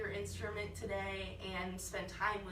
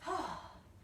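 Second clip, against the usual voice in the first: relieved sigh; 9 semitones higher than usual. Real, not acted. After holding breath long.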